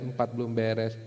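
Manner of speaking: normal talking voice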